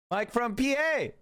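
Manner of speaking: slow, silly, put-on announcer voice; calls a chatter's name slowly in a goofy put-on voice. Streamer calling out a chatter's name, slow goofy voice.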